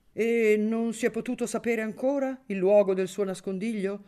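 a lightly voiced big booming voice; just a hint of a hearty, big-voiced old man; mild booming voice. A narrator's reading. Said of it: "col suo vocione".

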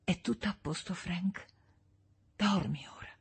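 soft, gentle reassurance; hushed bedside murmur; soothing hush. A narrator's reading. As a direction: lull him back to sleep. Woman soothes dreaming husband to sleep.

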